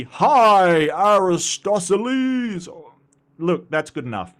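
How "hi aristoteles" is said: loud, high, drawn-out hype announcer. Pretend. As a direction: boom the names out like a ring announcer. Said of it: "I do an announcer voice".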